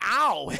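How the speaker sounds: loud cartoon ow; quick mock cry of pain; pained yelp. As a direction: cry out at a bandage ripped off. Bandage ripped off, acted.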